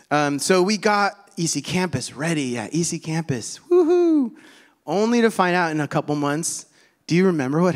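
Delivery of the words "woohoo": high, loud "woohoo"; celebratory cheer. Pretend. Pastor celebrating the campus being ready, high cheering whoop.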